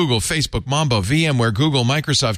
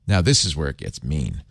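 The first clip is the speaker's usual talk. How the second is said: ordinary talk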